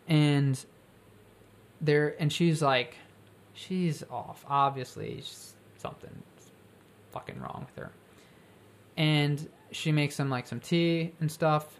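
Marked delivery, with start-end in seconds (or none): 3.5-7.9 ominous hush. A narrator's reading. Ominous description of the woman.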